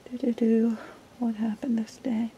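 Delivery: whispering to herself. Real, not acted. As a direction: breathe the question. Muttering to herself while flipping.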